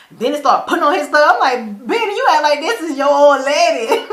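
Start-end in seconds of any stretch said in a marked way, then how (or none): 1.9-4.0 louder, higher, laughing rebuke; amused scolding. Retold or imitated. Viewer teasing Benny as he dresses, laughing. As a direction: call him out through a laugh.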